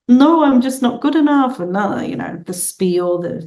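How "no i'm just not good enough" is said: put-on higher, louder, hurt young voice; self-pitying reply. Telling her mentor she is not good enough, hurt high voice. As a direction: wail it a bit, half mocking her old self. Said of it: "And I said".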